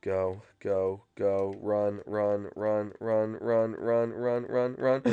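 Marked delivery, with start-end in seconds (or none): none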